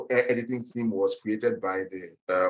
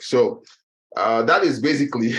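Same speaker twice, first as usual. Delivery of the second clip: breathless, gasping between words; out of breath; 3 semitones higher, 7 dB louder than usual. Real, not acted. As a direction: talk while catching your breath. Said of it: "I'm out of breath".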